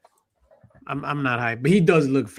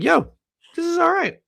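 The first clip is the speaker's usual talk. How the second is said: high mock voice. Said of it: "I was just like".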